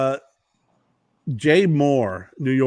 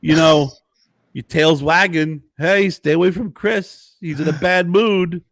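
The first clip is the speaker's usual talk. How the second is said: higher, animated oddball cadence; Christopher Walken impression; 5 semitones higher, 3 dB louder than usual. Walken explaining expressive tails to Jay, animated impression. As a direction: explain it in halting, punched phrases. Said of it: "He goes".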